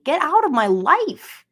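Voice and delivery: usual speaking voice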